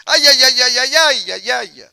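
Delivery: hammed-up string of frightened yelps; voces de miedo. Shown as a demonstration. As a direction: cry out high and loud in mock fear, then fade. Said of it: "¿Y cuáles serían las voces de miedo?". (gritos de miedo fingidos)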